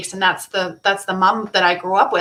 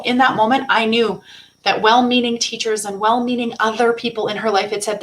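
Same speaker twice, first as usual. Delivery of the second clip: ordinary talk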